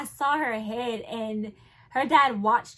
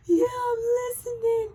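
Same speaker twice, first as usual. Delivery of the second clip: high tearful reply; 12 semitones higher, 4 dB louder than usual. Retold or imitated. Answering while in labor pain.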